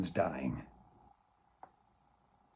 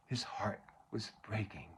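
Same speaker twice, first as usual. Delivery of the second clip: whispered; 5 semitones lower, 12 dB quieter than usual. Acted. Quiet remark.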